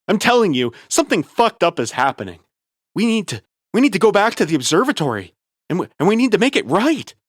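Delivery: high, loud, rushed panic; frantic. Acted. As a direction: plead fast and frantic. Frantic friend pleading.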